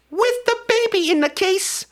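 very high-pitched climactic reveal; incredulous hype. Real, not acted. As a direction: sell the absurd twist, voice shot way up. Building to absurd climax.

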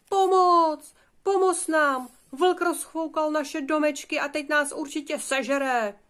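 high, loud cries for help; panicked call for help. Acted. Frightened piglets calling to their eldest brother, panicked. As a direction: call out in high-pitched alarm. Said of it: "volali na Chytrolína".

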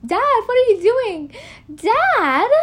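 high-pitched mock panic; acted frightened teen plea; alarmed son. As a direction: cry out to Dad in rising alarm. Isaac alarmed as he is tied.

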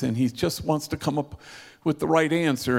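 ordinary talk

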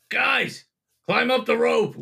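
high-pitched call down to friends. Acted. Game master calls down to players.

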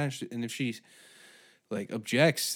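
ordinary delivery

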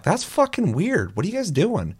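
high-pitched, swooping retold accusation; incredulous challenge. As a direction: draw it out, pitch leaping up in disbelief. Calling out two suspicious players.

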